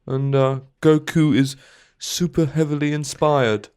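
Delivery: lightly voiced documentary-maker read; a hint of a gentle, curious British presenter; Louis Theroux impression. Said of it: "uh, Louis Theroux".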